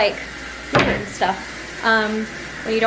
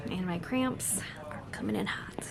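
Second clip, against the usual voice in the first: pained whisper; 3 semitones lower, 11 dB quieter than usual. Real, not acted. Complaining of period cramps.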